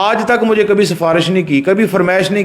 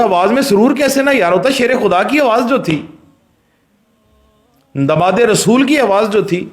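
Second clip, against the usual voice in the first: impassioned preaching; 5 semitones higher than usual. Acted. Rising praise of Ali.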